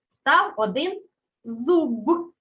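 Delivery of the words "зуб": зуб with a fully voiced b at the end; voiced final consonant. Shown as a demonstration. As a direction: release the б in зуб as a clear "b", never p. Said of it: "Вимовляємо дзвінко".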